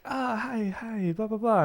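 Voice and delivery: high, soft imitation of friendly greeting; mock a sugary hello, lightly; fake friendly. Host imitates superficial American friendliness, fake-friendly chatter. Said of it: "that's why they're so friendly".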